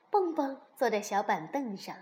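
ordinary delivery, nothing marked

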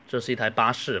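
ordinary talk, nothing marked

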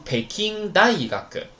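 Peking大学 as one word, pitch held up into "dai" before falling; compound pitch accent. Shown as a demonstration. Teacher links Beijing and university, compound pitch accent. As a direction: run pekin-daigaku together in one high arc for Peking大学. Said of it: "音调有变化啊".

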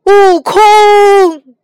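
high, very loud cry of the name; anguished cry. Acted. Tang Monk calling his wronged disciple back, anguished shout. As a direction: call out with all his last strength. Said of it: "呼喊着".

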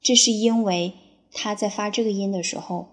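usual speaking voice

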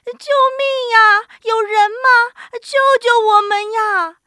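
high, loud cries for help; terrified animals bawling for rescue; wailing. A narrator's reading. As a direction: wail desperately, stretching the words. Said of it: "三个动物嚎啕大哭".

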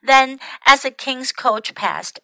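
usual speaking voice

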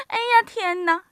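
high, flustered exclamation; bashful old woman. A narrator's reading. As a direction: act modestly overwhelmed by an honour. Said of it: "不好意思地说".

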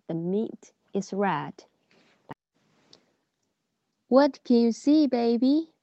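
slow, clear sing-song reading; reading to a toddler. Acted. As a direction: read it out to a small child. Reads English model lines to children.

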